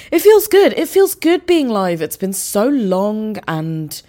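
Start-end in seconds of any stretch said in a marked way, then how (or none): 0.1-2.0 bursting with excitement, pitched up high; gushing about being back on air; excited. Real, not acted. Thrilled to be back live.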